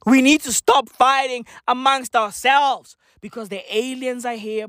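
ordinary talk, nothing marked

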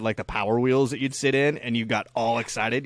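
ordinary talk, nothing marked

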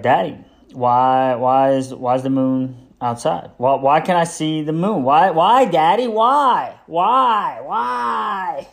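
rising, insistent whys; nagging toddler. Retold or imitated. The two-year-old pestering his dad about the moon. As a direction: keep asking why, building up. Son repeating why.

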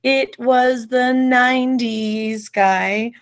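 high, drawn-out quote of a comedian; "nineties guy" impression. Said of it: "who they were talking about".

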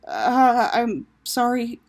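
halting, then a loud high "who?"; stumble, thrown, rising sharply at the end; faltering. A narrator's reading. Taco thrown by a caller who knows him, faltering. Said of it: "His voice faltered".

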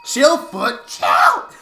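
high, loud yelling at a cramping foot; pained yell. Real, not acted. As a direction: shout through the cramp pain.

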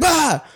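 sudden high, loud fake sneeze; explosive sneeze. Imitating a classmate who sneezes in class, explosive sneeze. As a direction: burst out with a mock sneeze scream. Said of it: "her sneezes were crazy".

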